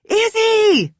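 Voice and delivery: piercing high shriek of a name. Acted. Kenzie shrieking for Izzy while holding the door, panicked. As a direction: shriek for your roommate. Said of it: "Kenzie shrieked again".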